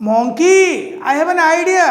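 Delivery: spring the plan with excitement; eager call. Acted. Crocodile calls with new plan.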